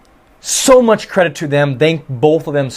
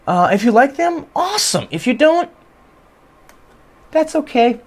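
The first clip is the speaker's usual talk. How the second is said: much higher, bouncy, teasing lilt; playful sign-off; 10 semitones higher than usual. Real, not acted. Signing off to viewers who may dislike the channels, playful and high. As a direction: tease the viewers lightly with a laugh.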